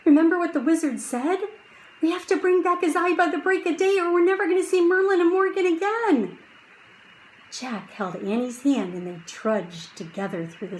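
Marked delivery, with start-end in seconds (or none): 0.0-6.4 high, rapid, breathless urging; fast urgent. Acted. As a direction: rush through the warning. Urgent reminder to sister.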